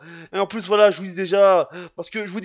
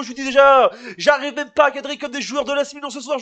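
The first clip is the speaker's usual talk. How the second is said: ordinary talk, nothing marked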